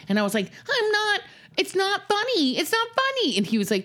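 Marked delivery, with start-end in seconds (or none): 0.7-3.4 squeaky protest. Retold or imitated. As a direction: pretend to agree while fighting giggles, voice way up. She protests while cracking up.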